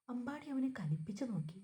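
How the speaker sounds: plain reading voice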